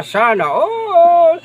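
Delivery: very high, slow, stretched sana all; playful sana all. Pretend. Teasing viewers before saying goodbye, high and stretched. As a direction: squeal it out long and high.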